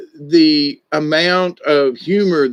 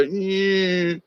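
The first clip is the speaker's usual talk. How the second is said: unimpressed yeah; 6 semitones higher, 5 dB quieter than usual. Retold or imitated. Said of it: "I watched it and I go".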